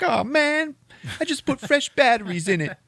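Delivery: very high whining with a laugh; mock high whine, laughing through it; whiny complaint. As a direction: whine about the ruined boombox. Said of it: "And he's like".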